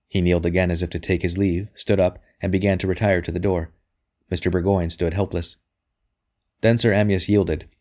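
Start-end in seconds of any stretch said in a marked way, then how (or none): none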